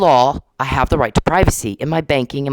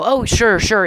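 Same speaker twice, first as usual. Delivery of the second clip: mock eager agreement; 6 semitones higher, slower than usual. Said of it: "you guys think I'll go".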